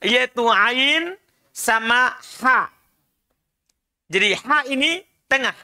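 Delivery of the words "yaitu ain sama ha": slow, throaty "ain" and "ha"; slow throat-letter demo; mid-throat letters. Shown as a demonstration. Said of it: "tenggorokan bagian tengah".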